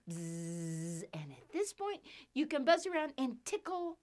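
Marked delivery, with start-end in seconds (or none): 0.1-1.0 a long buzzing bzzz like a bee; buzzing bee noise. Shown as a demonstration. Teacher buzzing like a bee for children. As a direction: buzz like a bee for the kids.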